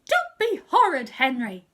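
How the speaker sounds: very loud, high angry shout. Acted. Mum shouts at Henry, thinking he's horrid, full angry shout. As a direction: yell it at full force. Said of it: "she shouted".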